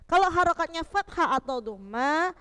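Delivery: ordinary talk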